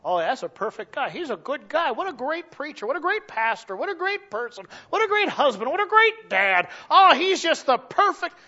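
high, loud gushing praise; admiring churchgoers. Retold or imitated. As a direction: gush like a fan at the church door. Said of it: "so people".

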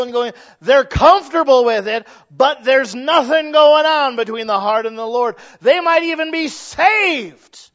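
rising from firm to a loud, high peak; preaching at full force. Acted. Preacher building to a loud peak for the congregation.

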